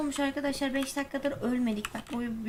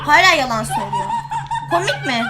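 laughing denial; 7 semitones higher, 15 dB louder than usual. Real, not acted. Denying she screamed, laughing.

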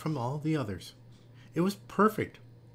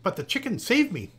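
higher-pitched, eager telling; excited explaining; 6 semitones higher, 3 dB louder than usual. A narrator's reading. Rescued Jessica tells her friends, excitedly. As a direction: gush about the rescue.

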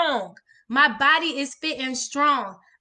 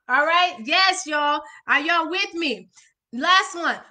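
ordinary delivery

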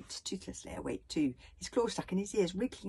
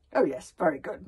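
ordinary delivery, nothing marked

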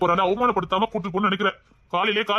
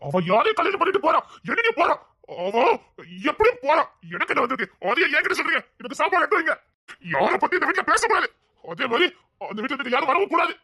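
heated, raised-pitch tirade; angry outburst; 3 semitones higher than usual. Acted. Son rants at his mother about the girl's wedding, angry. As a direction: lay down the law in anger. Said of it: "உனக்கு என்ன கோவம் வருது".

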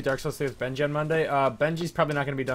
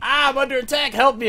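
high, loud imitation of panic; mock panicked cry for help; 12 semitones higher, 10 dB louder than usual. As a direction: yell for help, hamming up the alarm. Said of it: "when you were like".

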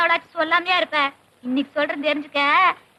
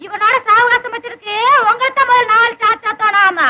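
higher, louder indignant rant; angry scolding; 4 semitones higher, 5 dB louder than usual. Acted. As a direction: lash out at the whole lot. Woman still scolding after the beating. (ஆத்திரத்தோடு திட்டுதல்)

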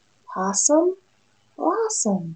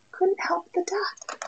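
whispering; 10 semitones higher, 7 dB quieter than usual. A narrator's reading. Whispered sad aside.

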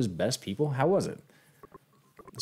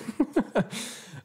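high breathy laugh; 14 semitones higher than usual. Real, not acted. Laughing at the line.

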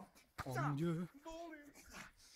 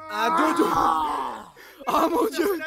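laughing; 6 semitones higher, slower than usual. Real, not acted. Commentator laughing at play.

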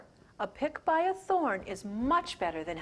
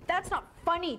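higher, louder sulky protest; upset kid; 7 semitones higher, 5 dB louder than usual. Acted. Gordy answers Chucky, kid anger. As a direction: snap back like a hurt boy. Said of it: "Gordy was really angry".

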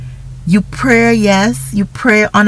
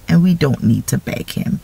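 ordinary delivery

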